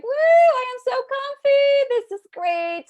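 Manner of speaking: high, loud, drawn-out squeal of delight; mock delight. Mimicking someone inside their comfort zone, mock delight. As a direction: gush in a high put-on voice.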